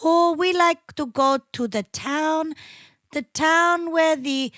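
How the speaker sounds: put-on slow singsong Swedish accent; mock Swedish accent. Mocking how ABBA used to talk, slow Swedish accent. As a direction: parody Swedish speech, drawing out each phrase. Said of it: "That's how they fucking talk".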